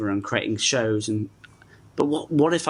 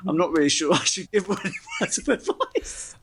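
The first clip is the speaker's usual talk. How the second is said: laughing through the words, pitched higher; 5 semitones higher than usual. Real, not acted. Doubting he should advise anyone after that, laughing. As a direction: let laughter break into the sentence. Laughing at own embarrassing story.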